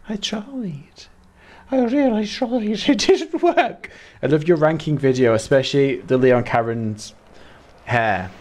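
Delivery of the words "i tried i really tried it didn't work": high-pitched, exasperated Mancunian wail; Mancunian accent. Real, not acted. Player failing the jump, wailing in Mancunian. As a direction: go proper Mancunian, pitched right up. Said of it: "Did you hear how Mancunian I went?".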